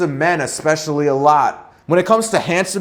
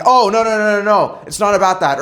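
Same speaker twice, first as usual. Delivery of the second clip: reactive tone; 4 semitones higher, faster than usual. Shown as a demonstration. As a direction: defend yourself in an anxious rush. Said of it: "not a more reactive tone like".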